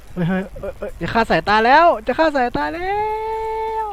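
panicked outcry. Real, not acted. Player struggling with the eye minigame, panicked cry. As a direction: yelp as the minigame strains the eyes.

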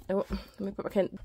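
normal talking voice

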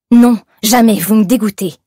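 loud, forceful rejection; disgusted refusal. A narrator's reading. Young woman refusing a kiss, loud disgusted refusal. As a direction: throw it at him loudly, revolted.

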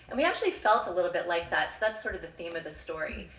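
usual speaking voice

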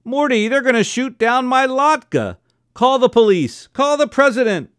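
high frantic pleading; frantic mother begging her husband; panicked. A narrator's reading. Frantic mother begging husband to save her latke, panicked.

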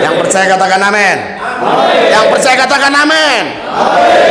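high, loud, fast call for an amen; rousing preacher's cry; rallying shout. Real, not acted. Preacher calls congregation to say amen.

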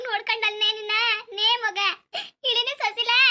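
loud keening cries calling the dead sister's name; wailing lament. Acted. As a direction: wail her name, voice high and loud, breaking into sobs. (ಜೋರಾಗಿ ಗೋಳಾಡುತ್ತಾ ಅಳುವುದು)

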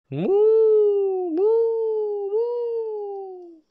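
very high, drawn-out moos; cow mooing. Pretend. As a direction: moo like a cow for little kids. Cow sound for children.

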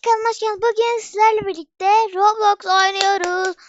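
fast, loud, high YouTuber greeting; loud vlog intro. Acted. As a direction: hype up the viewers at the start. Energetic channel intro. (enerjik kanal açılışı)

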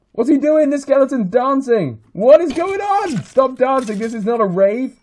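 gawk at a dancing skeleton; baffled. Real, not acted. Gamer baffled by a dancing skeleton, rising cry.

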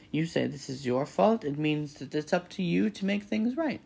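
bossy goose, rising in pitch; scolding goose. A narrator's reading. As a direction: lay down the law as a goose.

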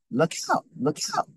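mild acted alarm; crying out. A narrator's reading. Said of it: "cried a voice".

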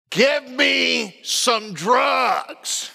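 very slow, drawn-out possessed growl, imitated; mock gurgly voice. Wife in labor to coaching husband, mock gurgly demon voice. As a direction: stretch every word like a hammed-up demon. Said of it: "It was a deep, gurgly voice".

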